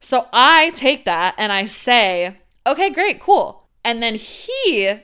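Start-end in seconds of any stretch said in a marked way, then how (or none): 2.7-3.7 chipper okay. Retold or imitated. Feigned casual reply.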